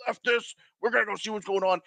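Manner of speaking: a hint of an exasperated father; fed-up dad. Dad quoted deciding.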